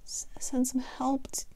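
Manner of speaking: breathless whisper, stammered; gasping whisper. Acted. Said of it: "he gasped".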